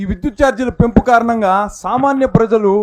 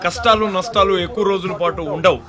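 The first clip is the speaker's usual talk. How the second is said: rousing political slogan. Acted.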